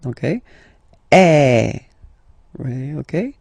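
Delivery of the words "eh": loud open eh for circonflexe; open French ê. Shown as a demonstration. Teacher demonstrating circonflexe sound. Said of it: "it's the open one".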